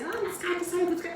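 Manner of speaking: high, put-on voice of a card reader; mock mystic. What she couldn't say to her ex, mock psychic voice. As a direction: play a dramatic fortune teller. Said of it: "eu não podia falar assim".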